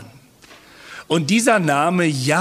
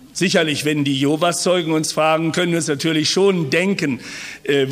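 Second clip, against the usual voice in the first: ordinary talk, nothing marked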